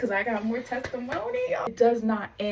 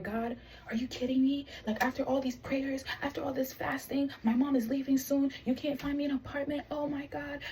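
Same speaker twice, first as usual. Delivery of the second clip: higher, softer mock-crying complaint; retold tearful plea; 5 semitones higher, 6 dB quieter than usual. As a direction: plead with God as if crying, high and hushed. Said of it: "I'm crying".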